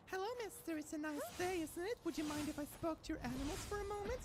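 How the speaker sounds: high, sweet princess voice. Pretend. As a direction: chirp it like a Disney princess.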